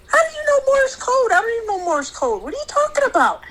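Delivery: high, fast disbelieving questions; incredulous mom. Retold or imitated. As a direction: ask it like you can't believe your ears. Mom's incredulous retold quote.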